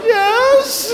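squeaky, high laughing yes. Retold or imitated. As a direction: blurt it out high through laughter.

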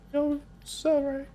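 high, soft, slow mocking "sorry"; mock apology. Streamer imitates viewers apologising for the game pick, high and soft. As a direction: lightly mimic a sheepish viewer. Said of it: "they're like".

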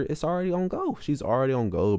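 normal talking voice

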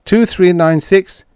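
calling a number into the radio, rising. Acted. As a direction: hail the ship loud and urgent. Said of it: "Retief called".

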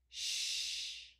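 "Sh" as a sustained rush of air; long sh sound. Shown as a demonstration. Teacher naming the digraph, long "Sh". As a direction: hold "Sh" without voice. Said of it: "The sound is long".

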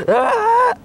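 sudden high yell of dismay; a high, anguished yelp; frustrated shout. Acted. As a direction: cry out as the thing breaks. Puppet sees his thing destroyed.

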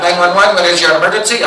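put-on emergency operator with Filipino accent; polite script in put-on Filipino accent. Operator answers emergency call, Filipino accent. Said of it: "outsource nine one one to the Philippines".

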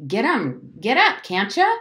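loud, high urgent order; screaming. A narrator's reading. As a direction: shout them onto their feet. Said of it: "Jem was screaming".

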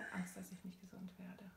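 breathy coach whisper; softly whispered tapping phrase. Real, not acted. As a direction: murmur it gently under the breath.

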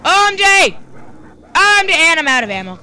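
high, loud frantic cry; panicked. Real, not acted. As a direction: cry it out in alarm. Panic in game.

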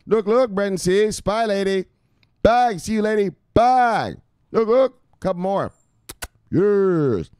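high, loud hype voice; high-pitched calling out with punchy bursts; hyped shout-out. Pretend. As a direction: belt out each greeting like a catchphrase. Calling out shout-outs to followers.

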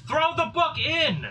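high, loud, drawn-out command; full-voiced urgent character shout; yelling. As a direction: yell it slowly across the battlefield. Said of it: "Gren yells".

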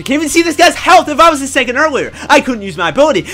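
high, loud frustrated yell; raging. Real, not acted. Gamer frustrated at missing kill by seconds, raging. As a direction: complain at full pitch. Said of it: "I'm the one raging".

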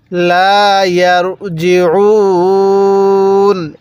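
slow Quranic recitation with a held "ইয়ারজিউন"; elongated recitation. Shown as a demonstration. Teacher showing learners how to stop on ইয়ারজিউন, long drawn-out recitation. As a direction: draw out the last vowel of ইয়ারজিউন very long. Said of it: "এরকমভাবে শিখব".

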